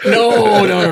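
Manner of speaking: loud, high drawn-out no; mock protest. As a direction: refuse in hammed-up alarm. Mock refusal as the preacher.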